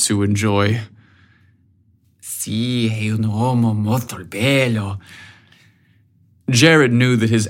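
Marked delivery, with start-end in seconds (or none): 2.2-5.0 Italian aunt. A narrator's reading.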